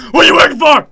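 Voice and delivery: high, very loud demand; mock furious shout. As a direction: yell it as if shaking someone, hammed-up. Pretends shaking her by the chest.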